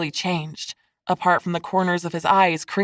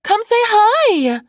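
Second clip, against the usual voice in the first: very high, drawn-out loud call; 6 dB louder, slower than usual. A narrator's reading. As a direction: beckon loudly across a beach. Kylie calls Seth over.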